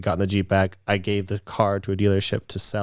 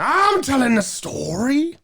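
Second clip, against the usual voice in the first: mock indignant; slower than usual. Teasing partner who interrupted.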